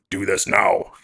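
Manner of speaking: forceful order, no softness; forceful command. Shown as a demonstration. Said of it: "It doesn't have to all be forceful".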